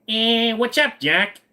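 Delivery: high cartoon rabbit voice; Bugs Bunny. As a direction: open on a high, drawn-out "eh". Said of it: "you talk like Bugs Bunny".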